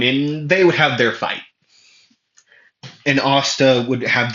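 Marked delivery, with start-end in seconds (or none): none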